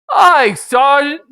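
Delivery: slow, high, loud reply; ogre shouts aye. Acted. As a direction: snap to it with a booming yes. Ogre answers sergeant.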